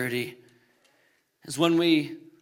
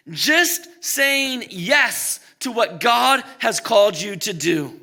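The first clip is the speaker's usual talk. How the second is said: impassioned preaching; 6 semitones higher, 4 dB louder than usual. Real, not acted. Preacher urging church to make themselves available to God, impassioned. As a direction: preach it loud and high, leaning on key words. Preacher builds to full force.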